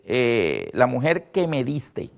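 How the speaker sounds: low, soft, slow excuse; sheepish Adam. Retold or imitated. Adam blaming the woman before God, low sheepish mumble. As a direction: mumble the excuse like a caught man.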